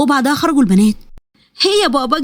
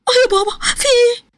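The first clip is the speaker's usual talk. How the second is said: high, startled cry of pain; 11 semitones higher than usual. Acted. The daughter cries out, struck by her father, in high-pitched pain. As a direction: yelp as she's struck.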